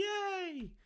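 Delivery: high, soft drawn-out "yay"; cheer. Real, not acted. Host cheering free cyber tech, high soft squeal.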